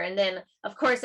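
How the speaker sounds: normal talking voice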